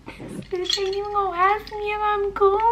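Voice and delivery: very high, squeaky mock-crying voice; mock bawling. Woman sobbing about the uncaring desk lady, hammed-up high-pitched bawling. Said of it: "so I'm literally bawling".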